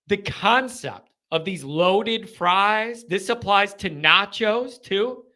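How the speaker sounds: ordinary talk, nothing marked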